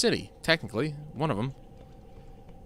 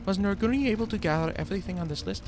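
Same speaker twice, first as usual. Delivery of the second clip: high-pitched quest-giver question; higher-pitched character voice; 9 semitones higher, faster than usual. Acted. Caregiver asking about the supply list, higher-pitched voice. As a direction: read it in a put-on higher voice. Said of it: "the attempt at the higher pitched voice".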